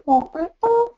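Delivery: very high, squeaky parrot "What?"; parrot squawk. Retold or imitated. Retelling the parrot's reply, high squeaky parrot call. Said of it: "And then she'd go".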